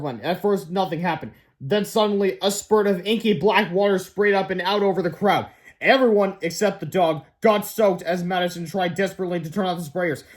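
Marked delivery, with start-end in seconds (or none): none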